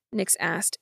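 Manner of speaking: plain reading voice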